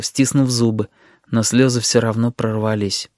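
plain reading voice

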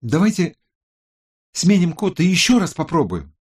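high, loud-ish woman's character voice; Роза. A narrator's reading. Tech expert proposes another attempt, high and urgent. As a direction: pipe up with an urgent suggestion. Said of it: "предложила Роза".

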